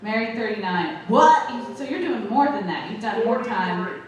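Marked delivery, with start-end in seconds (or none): 1.1-1.5 loud disbelieving what; surprised shout. Real, not acted. Comic hears the couple's forty-three years, loudly amazed. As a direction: bark it out amazed.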